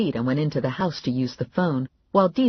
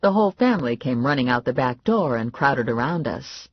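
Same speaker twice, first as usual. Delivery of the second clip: normal talking voice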